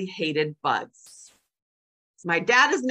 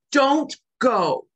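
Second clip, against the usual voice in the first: higher, louder insistent demand; dictating toddler; 5 semitones higher, 5 dB louder than usual. Retold or imitated. Grandson telling grandma not to leave, loud insistence. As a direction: order it like a clingy little boy. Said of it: "he dictates".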